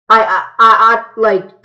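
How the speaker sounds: repeating I, I, I; stuck on the first word; stuttering. Real, not acted. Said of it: "sorry if I'm stuttering".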